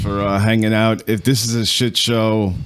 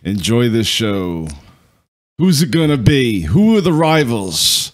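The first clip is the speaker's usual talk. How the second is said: hype intro. Acted. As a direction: announce it like a host. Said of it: "let's get into this intro".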